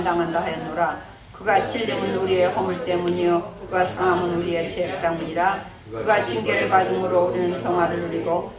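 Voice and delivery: steady solemn Bible reading; formal scripture recitation. Acted. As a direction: read the verses evenly and solemnly. Reads scripture aloud.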